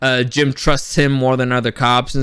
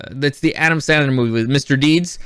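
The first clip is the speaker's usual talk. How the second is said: usual speaking voice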